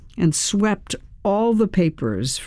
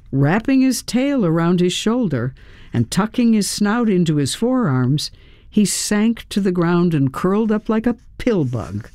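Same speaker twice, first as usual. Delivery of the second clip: usual speaking voice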